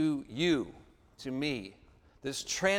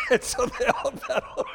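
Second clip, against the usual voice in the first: laughing; 4 dB louder than usual. Real, not acted. Laughing at his own story.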